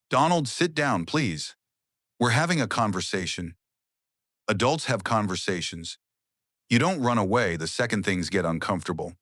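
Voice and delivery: ordinary delivery, nothing marked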